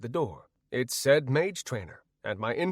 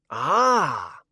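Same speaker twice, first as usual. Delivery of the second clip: loud, drawn-out pleased "ah"; affable old man; 2 semitones higher, 5 dB louder than usual. A narrator's reading. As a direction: greet affably as an old mage. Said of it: "he said affably".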